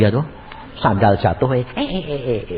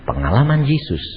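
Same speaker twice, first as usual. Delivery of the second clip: ordinary talk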